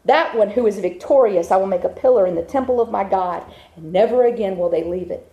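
ordinary delivery

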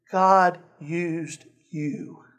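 very slow, soft words; telling an old man slowly, softly; slow, earnest. Retold or imitated. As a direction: let each word land gently.

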